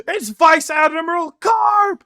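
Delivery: high, loud, slow terrified shout; panicked pirates. Retold or imitated. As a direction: scream the name in panic. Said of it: "the pirates go".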